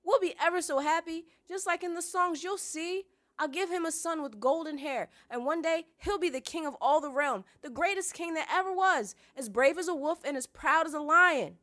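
high, loud, rapturous insistence; starry-eyed girl pleading loudly; insistent. A narrator's reading. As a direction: gush stubbornly at full volume. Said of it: "Sansa insisted".

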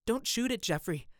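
high-pitched pleading outcry; urgent plea, rising to a loud cry. Acted. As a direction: cry out to stop him, pitch leaping up. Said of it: "he cried out at once".

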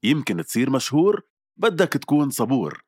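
bright commercial voiceover, slowing at the end; ad read. Acted. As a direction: sell it like a radio spot. Reads radio ad script.

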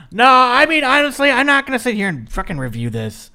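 loud and fed up; exasperated. Real, not acted. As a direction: blurt it out, fed up. Exasperated outburst.

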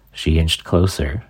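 usual speaking voice

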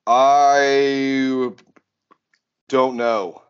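one long held "I", then a pause; slow, evasive admission with a long gap; drawn-out hedging. Real, not acted. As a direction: stall on a stretched-out first word. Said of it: "Why are you saying it like that?".

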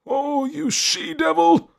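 slow, high, loud dying cry. A narrator's reading. Dying lord curses his wife, crying out. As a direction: cry out in agony and fury, drawn out. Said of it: "and he cried out".

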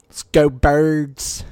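put-on slow, drawn-out Philly sports-fan accent; slow Philly accent cheer. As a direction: chant it slowly like an Eagles fan from Philly.

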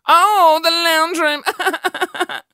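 very slow, high, loud mock wail; fake crying [name]. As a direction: stretch it out into a theatrical sob. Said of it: "With [name] crying".

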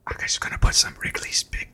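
breathy mock ASMR whisper. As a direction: whisper close to the mic.

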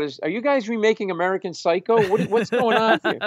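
high-pitched incredulous question; re-enacted baffled outburst; blurted out. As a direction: burst out in bewildered disbelief. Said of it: "I just blurted out".

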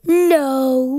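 loud put-on silly monster voice; grumpy guitar voice. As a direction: bark it out as a cranky guitar. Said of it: "Okay, guitars?".

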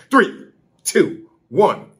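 high, bossy countdown; mock attendant countdown. Attendant bossing riders through the countdown, high and bossy. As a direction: order the riders like a drill. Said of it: "Some lady".

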